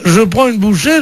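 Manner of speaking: ordinary delivery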